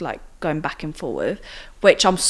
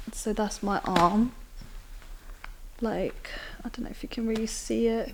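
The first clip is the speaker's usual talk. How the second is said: hushed, breathy whisper; 4 semitones higher, 9 dB quieter than usual. Real, not acted. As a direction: whisper it while showing the scar. Showing scar on camera.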